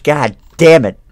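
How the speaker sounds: loud, high startled swearing; real fright; frightened curse. Real, not acted. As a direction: spit it out in shock.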